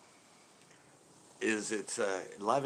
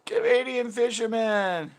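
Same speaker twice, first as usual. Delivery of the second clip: high, loud, drawn-out announcement; calling out a greeting; 13 semitones higher, 8 dB louder, slower than usual. Real, not acted. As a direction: hail a newcomer with a slow holler. Calls out a greeting to newcomer.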